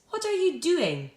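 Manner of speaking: high-pitched asking What are you doing; questioning. Shown as a demonstration. Teacher reading Amy's question to pupils, asking. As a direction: ask it with lifted pitch. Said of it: "asked Amy".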